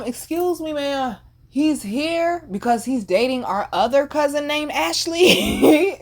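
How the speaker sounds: mock polite rebuke of a flirting cousin; snooty. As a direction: correct her with fake politeness.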